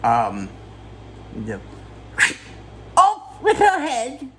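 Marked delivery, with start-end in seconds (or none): 2.9-4.3 high, slow, put-on voice; mock royal decree. Summarising the beheading, declares it in a high mock voice. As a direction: proclaim it grandly in a silly high voice.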